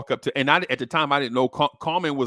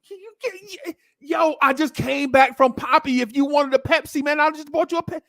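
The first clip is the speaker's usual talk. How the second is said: high, whining protest in a singsong; exasperated kid. Retold or imitated. Boy protests to his uncle that he just went to the store, high and whiny. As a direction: whine it like an annoyed kid.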